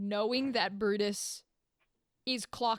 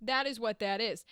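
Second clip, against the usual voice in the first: ordinary delivery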